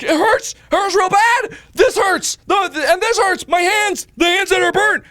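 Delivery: high loud whining complaint; pained whine. Retold or imitated. Patient whining to staff about his burnt hands, high and loud. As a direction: whine like a hurt child. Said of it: "And like".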